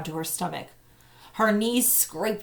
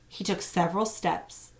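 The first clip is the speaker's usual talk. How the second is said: ordinary delivery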